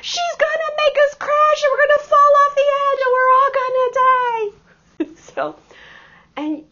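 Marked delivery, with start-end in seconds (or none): 0.0-4.5 very high, loud imitation of a terrified passenger; panicked yelling. Frightened student yelling as the driver takes the sharp curve, imitated. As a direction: shriek the retold panic, building to a wail. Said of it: "there's yelling".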